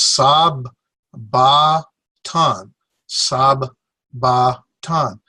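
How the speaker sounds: "sab ba tan" in three beats, both b's sounded; separated consonants. Shown as a demonstration. Teacher separating doubled consonants, "sab... ba... tan" piece by piece. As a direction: break it as "sab", pause, "ba", "tan". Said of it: "we separate them in a pronunciation".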